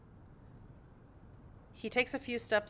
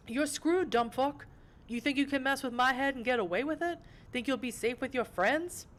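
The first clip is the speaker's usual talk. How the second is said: higher-pitched thug voice, threatening; taunting Brujah tough; 7 semitones higher than usual. Acted. Hooded Brujah returns with friends, taunting tough-guy voice. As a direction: jeer like a cocky gangster.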